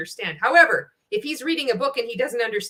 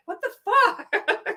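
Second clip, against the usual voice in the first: high, slow, laughing disbelief; 12 semitones higher, much slower than usual. Real, not acted. Reacting to the video, high slow laughing disbelief. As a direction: stretch it out in stunned laughter. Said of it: "I'm like".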